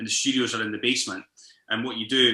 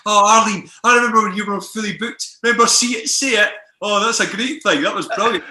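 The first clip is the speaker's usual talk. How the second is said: higher and louder, tipsy fan gush; 8 semitones higher, 6 dB louder than usual. Retold or imitated. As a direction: gush awkwardly at your hero. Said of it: "I like to be very honest when I'm out".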